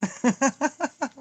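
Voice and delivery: high, bubbly laugh; giggle. Real, not acted. Laughing at own hair on stream, high giggle.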